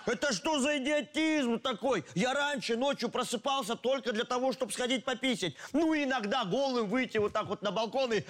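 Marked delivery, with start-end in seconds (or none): none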